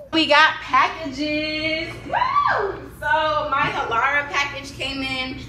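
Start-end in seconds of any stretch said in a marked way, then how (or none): 2.1-2.9 high, loud whoop; excited woo. Real, not acted. Mom whooping over her packages, high and loud. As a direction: whoop with delight.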